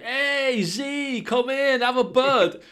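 high, put-on friendly lad voice; mock naive greeting. Mate greeting a zombie, high cheery mock voice. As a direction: play the clueless host welcoming a zombie.

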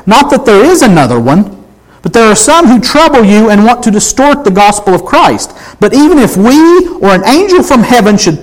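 high-pitched, emphatic preaching read; impassioned scripture reading. Acted. Preacher reads Paul's warning to the Galatians, impassioned. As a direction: read it out as urgent warning, slowing hard on the final curse.

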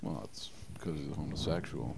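distant, off-mic voice; speaking away from the microphone; turned away from mic. Real, not acted. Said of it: "[name], talk into the mic".

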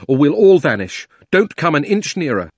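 loud, tense warning; crying out. A narrator's reading. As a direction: hold her off with a shout. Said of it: "cried Diggory".

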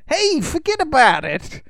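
high-pitched, hammed-up New York street kid. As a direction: brush someone off like a cocky New York kid. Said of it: "Typical New York kid".

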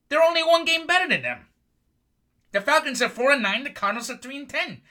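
high, loud exasperated yell; heated shout. Real, not acted. Host yelling at Falcons fans about the record, high and loud.

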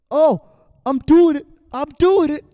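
very high, loud mock cheering; imitated excitement. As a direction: shout it like a kid on the verge of winning. Retold kid succeeding at stacking cups.

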